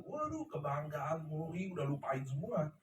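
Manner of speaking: quiet, low dismay, recounted; retold heartbreak. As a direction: murmur it like your past self at a loss. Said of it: "hancur itu hati". (menirukan hati hancur, pelan)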